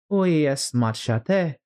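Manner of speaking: put-on slow, careful French from a girl; French phrase. Alice trying French on the mouse, slow French. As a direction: try out schoolgirl French slowly. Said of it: "a French mouse".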